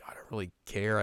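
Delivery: breathy whispered indifference; bored whisper. Retold or imitated. Voicing bored Sesshomaru.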